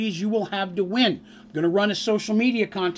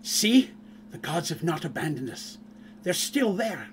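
dwarf paladin; 3 semitones lower, 6 dB quieter than usual. Acted. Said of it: "he turns to the elven woman and says".

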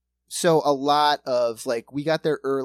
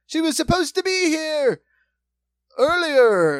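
high, projected mock stage shout; shouting to the audience; 12 semitones higher, 8 dB louder than usual. Cast members do a bit for the waiting crowd, hammed-up shouting. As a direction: ham it up as a ramparts performer. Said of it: "They're shouting to the audience".

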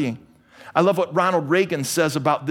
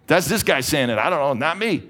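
ordinary talk

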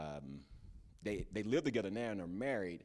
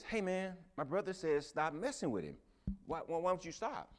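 higher, pushy challenge to a bully; confronting the bully; 7 semitones higher than usual. Acted. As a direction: press him like a protective brother.

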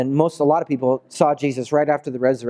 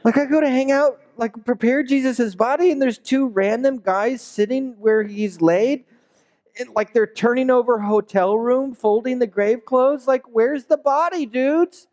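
high, put-out complaint; exasperated Mary; 10 semitones higher than usual. Acted. Mary griping to the preacher's listeners, high and exasperated. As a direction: vent like a fed-up mourner.

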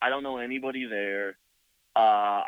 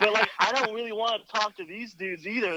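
laughing through the words, a little higher; 9 semitones higher than usual. Real, not acted. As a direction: chuckle while telling it. Laughing while retelling tense encounter.

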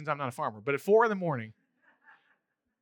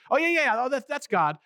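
mock recognition; 7 semitones higher, 5 dB louder than usual. Mimics shallow recognition of God.

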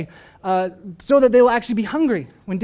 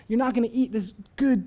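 usual speaking voice

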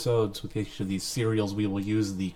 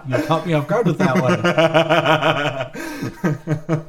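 laughing; 4 semitones higher, 6 dB louder, slower than usual. Real, not acted. Laughing at a joke.

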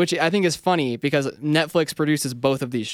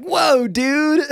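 much higher, louder stoked skater-dude whoa; nineties skater cat; 11 semitones higher, 5 dB louder than usual. Retold or imitated. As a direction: play a rad nineties cartoon cat on a skateboard. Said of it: "a cat on a skateboard from the nineties that's like".

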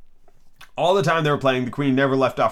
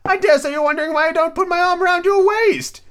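high-pitched storybook Duchess; Duchess character voice; 5 dB louder than usual. Acted.

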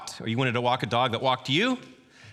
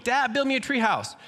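put-on much higher, louder little-kid plea; demanding kid; 12 semitones higher, 4 dB louder than usual. Preacher recalling his childhood self as a demanding kid. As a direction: beg like a six-year-old wanting his way. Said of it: "and I'm like".

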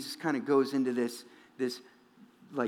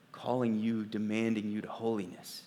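ordinary talk

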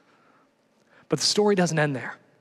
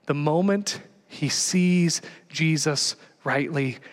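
ordinary talk, nothing marked

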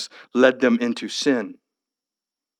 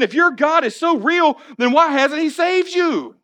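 slow, higher scornful taunt; mocking challenge; 14 semitones higher, slower than usual. Enemies challenging the people, slow scornful taunt. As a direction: challenge them with scorn, drawn out. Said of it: "They look to them and ask this question".

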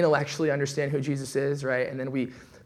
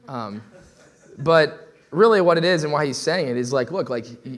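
ordinary delivery

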